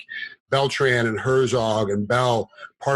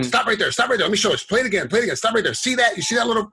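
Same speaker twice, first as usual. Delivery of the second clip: higher, excited TV analyst walking through a replay; replay breakdown commentator; 8 semitones higher, faster than usual. Retold or imitated. Fighter on a podcast imitating a commentator breaking down replays. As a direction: point out the replay like a color commentator. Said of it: "they tell you why it happened".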